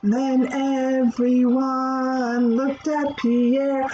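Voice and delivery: slow, lilting read-aloud voice; storybook reading. Acted. Teacher reading a rhyming story to children. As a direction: read it gently to little kids.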